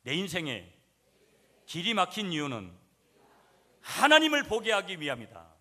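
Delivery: loud, high shout of a refrain for the congregation to repeat; preaching at full force. Shown as a demonstration. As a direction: proclaim it loudly so they echo. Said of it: "한번 따라하겠습니다". (크게 외치는 설교 선창)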